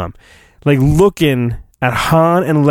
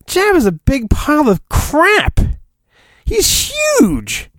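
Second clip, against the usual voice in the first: mock outrage; 11 semitones higher than usual. Real, not acted. Mocking the book's worm description.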